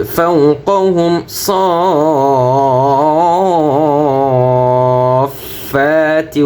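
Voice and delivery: صافات drawn out very slowly, long alif held four counts; four-beat stretch. Shown as a demonstration. Teacher showing students the four-alif stretch. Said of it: "চার আলিফ টান হবে".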